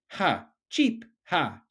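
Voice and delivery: very high cheep between mocking ha's; cheeping laugh. A narrator's reading. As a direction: chirp and laugh at her. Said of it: "cheeped the bird".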